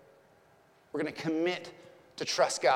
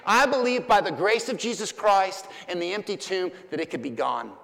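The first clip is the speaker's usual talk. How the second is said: raised, louder, emphatic promise; impassioned preaching; 5 semitones higher, 5 dB louder than usual. Real, not acted. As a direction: press the promise on the congregation.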